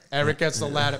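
usual speaking voice

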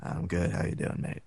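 hushed, low Australian imitation; tiny Australian voice in a huge hall; small Australian voice. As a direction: murmur it in an Australian accent. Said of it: "smallest voice ... in his Australian accent".

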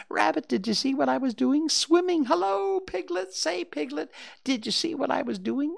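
put-on very high, squeaky excited child voice; proud little Roo. A narrator's reading. Roo boasts to Pooh, Rabbit, Piglet and Christopher Robin, high and squeaky. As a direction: show off to each friend in turn, breathless pride.

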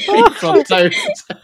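put-on high, slow, laughing English accent; mock English accent. Laughing back at the friend's example, high mock English accent. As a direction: drag it out in a squeaky put-on English voice. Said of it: "say it in an English accent".

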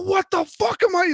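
high-pitched imitated outburst; mock scream. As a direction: mimic someone suddenly screaming, voice leaping up. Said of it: "he starts screaming really loud".

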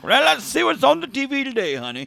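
put-on high cheerful old-timey dad; breezy sitcom husband tone. Imitating old Cap at home.

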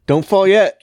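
high loud mock warning; mock urgent call. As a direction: call out to hold the fall. Joining the mock bit.

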